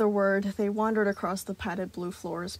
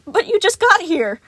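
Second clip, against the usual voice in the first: loud, high protest; 11 semitones higher, 13 dB louder than usual. A narrator's reading. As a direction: plead against it. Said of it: "Sun protested".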